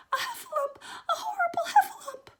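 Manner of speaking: shrieking, very high and loud cry for help; Piglet crying out. Acted. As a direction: squeal in panic. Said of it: "cried Piglet".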